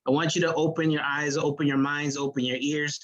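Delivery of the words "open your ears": ordinary talk, nothing marked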